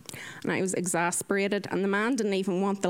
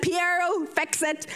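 exasperated order; 7 semitones higher, slower than usual. Retold or imitated.

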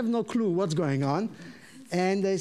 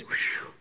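voiceless exhaled whew; whew of relief; 4 dB quieter than usual. Retold or imitated. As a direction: blow out a relieved breath. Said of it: "I did this".

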